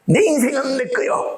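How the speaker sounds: a high, defiant mock claim; stubborn self-will. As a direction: play the headstrong believer insisting on his own way. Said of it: "막 그러고 싶잖아".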